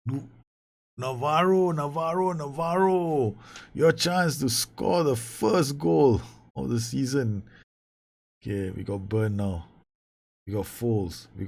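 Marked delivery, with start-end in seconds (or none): 0.1-7.4 high, slow reproach; mock scolding. Real, not acted. Manager scolding his striker for missing, mock reproach. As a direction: chide the player by name. Scolding the player who missed.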